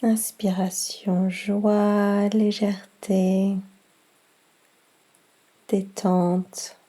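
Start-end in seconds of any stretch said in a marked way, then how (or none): none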